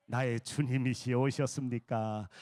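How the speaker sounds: low, slow, reverent greeting; 공경하는 인사. Shown as a demonstration. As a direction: greet them slowly as your lord. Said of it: "이제는 어떻게 해야 돼?". (낮고 느리게 공경하는 인사)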